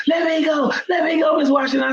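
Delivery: high, mock desperate kid yelling to be let go; a child begging to be released; pleading child. Struggling boy to the teacher, a mock high plea. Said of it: "And he was like".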